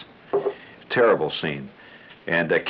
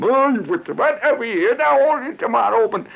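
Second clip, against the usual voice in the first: much higher, louder startled doctor; bitten doctor; 14 semitones higher, 4 dB louder than usual. Retold or imitated. Doctor whose thumb is bitten, high startled outburst.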